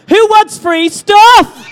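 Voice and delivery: loud, high, very drawn-out shout; bigger crowd call-out. Real, not acted. Host retries free stuff question on crowd, shouting it big. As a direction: bellow it slowly to rouse the whole crowd, stretching STUFF. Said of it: "Let's try that again".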